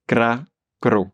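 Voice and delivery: "Cra, cro" with trilled r after c; rolled r. Shown as a demonstration. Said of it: "essayer avec d'autres consonnes".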